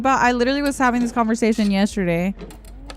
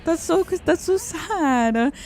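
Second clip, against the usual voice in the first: sad; 7 semitones higher, much slower than usual. Retold or imitated. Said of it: "I was like".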